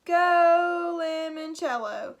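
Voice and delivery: slow, loud drawn-out cheer; made-up cheer. Shown as a demonstration. Reader cheers for the commercials, slow and loud. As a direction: stretch it out like a stadium chant. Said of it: "That's a cheer I just made up".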